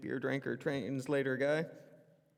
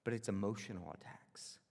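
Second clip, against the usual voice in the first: whisper; 9 semitones lower, 9 dB quieter than usual. Real, not acted.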